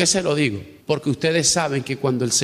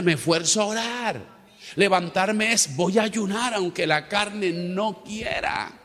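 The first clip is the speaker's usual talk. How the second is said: higher, slowed exhortation; impassioned slow preaching; fervent preaching; 6 semitones higher than usual. Acted. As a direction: plead with the congregation to rise. Rising exhortation.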